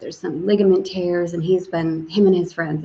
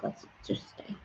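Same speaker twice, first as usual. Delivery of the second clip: whisper; 18 dB quieter than usual. Retold or imitated. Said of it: "I'm gonna be like".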